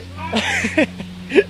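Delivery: high-pitched burst of giggles. Real, not acted. Distracted interviewee trying to get back on track, giggling.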